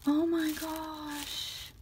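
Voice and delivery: loud, drawn-out cry of amazement, rising high with a gasp; overwhelmed surprise. Real, not acted. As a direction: gasp and exclaim, stretching each word. Overwhelmed on discovering the handmade gift.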